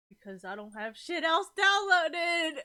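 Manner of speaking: slow, loud whine climbing high; drawn-out, high-pitched tearful complaint; tearful wail. Real, not acted. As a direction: wail it out, half crying. Streamer near tears, wailing an excuse.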